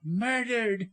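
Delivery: high, distraught cry; woman's horrified high exclamation; voice leaping up. A narrator's reading. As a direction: jump up in pitch on the dreadful word. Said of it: "her voice leaped into alto".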